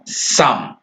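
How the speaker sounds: "some" said softly, breathy and drawn out; emphasized word. Shown as a demonstration. Teacher stressing "some" to the children. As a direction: lean on "some" as a whisper. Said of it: "I want to emphasize this word here".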